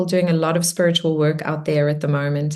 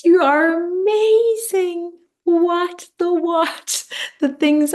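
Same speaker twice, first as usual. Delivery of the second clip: much higher, slow, gushing delight; excited; 11 semitones higher, slower than usual. Real, not acted. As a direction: burst out with amazed joy. Host gushing after the meditation.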